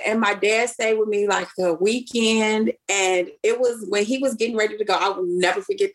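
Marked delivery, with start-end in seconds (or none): none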